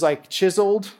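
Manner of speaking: ordinary delivery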